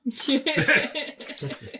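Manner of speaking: high, loud burst of laughter. Real, not acted. Player reacting to the bone remark, laughing.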